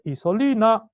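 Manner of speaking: very loud, high call of a name; imitated booming summons; pastor's powerful voice. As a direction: holler for someone in a big pastor's voice. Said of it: "potente que tiene mi pastor y dice".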